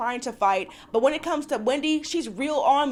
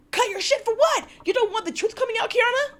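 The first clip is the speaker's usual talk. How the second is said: much higher, fast, disbelieving challenge; incredulous callout; 10 semitones higher than usual. Real, not acted. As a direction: demand answers, high and fast. Incredulous rant at Kiana.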